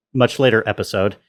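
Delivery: ordinary talk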